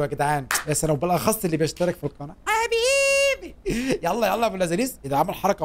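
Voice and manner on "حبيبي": long, very high drawn-out cry; gushing high-pitched shout-out; exuberant call. Real, not acted. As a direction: stretch it out high like a celebratory holler. Calling out affection to subscribers.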